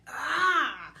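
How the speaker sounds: loud, high "ah" of raw drive; hungry battle cry, let it burst out; fierce roar. Acted. Showing that hunger and energy, fierce roar.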